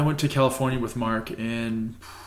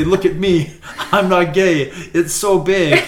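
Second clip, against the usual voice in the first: louder mock macho boast; 4 semitones higher, 6 dB louder, slower than usual. Friend mocking his mom's advice, loud mock boast. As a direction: puff up and brag loudly. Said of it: "Mark's like".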